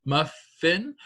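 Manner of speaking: muffin said in two clear beats, muf and fin; syllable demo. Shown as a demonstration. Teacher modelling syllables for second graders, clear demo. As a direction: sound out muffin for the class. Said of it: "If you did the word muffin".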